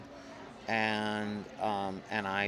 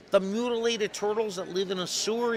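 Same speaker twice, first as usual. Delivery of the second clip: high, louder disbelieving question; mock incredulous; 12 semitones higher, 5 dB louder than usual. Illustrator checking with the strange caller, hammed-up incredulous. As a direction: pitch it way up in acted disbelief. Retells own baffled reply to caller.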